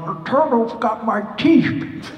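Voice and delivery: slow, high, loud old-man cartoon voice; Grandpa Simpson. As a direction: wail it like a cartoon grandpa, drawn out. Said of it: "Grandpa Simpson's voice".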